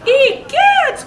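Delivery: very high, loud shocked exclamation; a shrieking startled mama bear; Mama Bear cries out. A narrator's reading. Reading to kids, Mama Bear exclaims in shock. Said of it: "cried Mama Bear".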